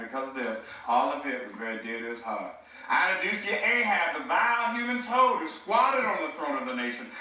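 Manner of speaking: lightly imitated old-school orator, grand and measured; a hint of a stately Southern preacher reading his sermon; understated imitation of a classic pulpit orator; R G Lee impression. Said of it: "I would imitate R G Lee".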